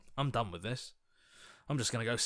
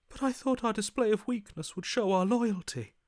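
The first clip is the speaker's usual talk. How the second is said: bewildered protest; 10 semitones higher, 4 dB louder than usual. Acted.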